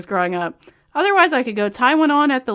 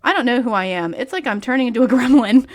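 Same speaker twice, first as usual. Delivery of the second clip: normal talking voice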